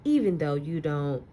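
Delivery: put-on expressive read-aloud to children, lingering on key words; story narration. Teacher reading a picture book to her class, expressive storytelling.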